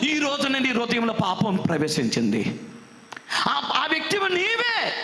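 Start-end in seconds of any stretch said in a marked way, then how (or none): 3.5-4.9 tearful accusation. Acted. Prophet confronts David over sin.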